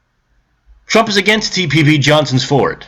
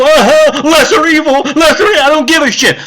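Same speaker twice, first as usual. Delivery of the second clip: high, dismissive mocking voice; mock shrug-off; 9 semitones higher, 3 dB louder than usual. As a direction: parrot their excuse with a sneer. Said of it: "You can go".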